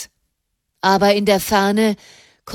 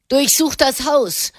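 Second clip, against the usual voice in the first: raised, higher angry shout; angry bellow; 6 semitones higher, 3 dB louder than usual. A narrator's reading. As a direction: order the search like a furious mob leader. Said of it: "gröhlte eine wütende Stimme". (wütend gegrölt, höher und lauter)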